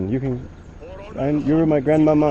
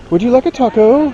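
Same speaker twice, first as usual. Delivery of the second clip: playful teasing; 10 semitones higher, 5 dB louder than usual. Real, not acted. Playful joke after taco example.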